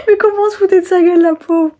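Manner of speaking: high, fast, giggling through words; laughing while talking. Real, not acted. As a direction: laugh as you say it. Laughing at mom's phrases.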